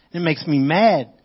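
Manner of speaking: ordinary talk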